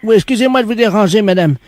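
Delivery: loud, put-on voice of an odd shop worker; a loud prank caller playing it dead serious. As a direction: fake tradesman on the phone, loud.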